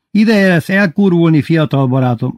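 teasing Bubu. Acted. (magasabb, hangosabb, csipkelődő hang)

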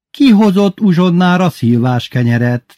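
high, loud interrogation; questioning teacher. A narrator's reading. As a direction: put the question sharply to the class. (számonkérő tanári hang)

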